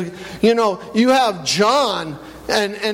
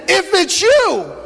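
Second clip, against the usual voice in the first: much higher, slow pleading question; eager plea; 10 semitones higher, 3 dB louder, slower than usual. Retold or imitated. Peter calls out to Jesus on the water, slow and high. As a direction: stretch it out high, longing to know. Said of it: "He just said".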